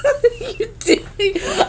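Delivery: squealing through laughter; laughing. Real, not acted. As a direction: crack up mid-question. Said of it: "I was laughing so hard".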